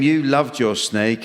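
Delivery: ordinary talk, nothing marked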